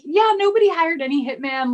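high, loud, offhand dismissal; breezy Nate. Retold or imitated. Host voicing Nate brushing off the marshal, high and breezy. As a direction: brush it off casually.

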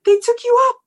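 high, loud, startled question; shocked disbelief. Retold or imitated. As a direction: gasp it out in disbelief. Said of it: "he is very much like".

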